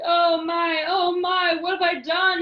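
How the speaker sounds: king moaning over what he has done. A narrator's reading. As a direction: wail it like a king full of regret. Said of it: "he moaned".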